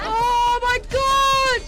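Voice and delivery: loud, high, slow cry of shock; real frightened shout. Real, not acted. Gamer still reeling from the scare, loud shocked cry. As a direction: let the fright burst out, dragged long. Jump scare in horror game.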